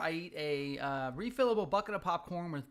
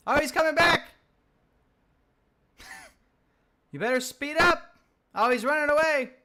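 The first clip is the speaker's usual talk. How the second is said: louder and higher, thrilled by the chase; excited shout; 9 semitones higher, 11 dB louder than usual. Real, not acted. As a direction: yell at the fleeing enemy. Chasing the enemy in game.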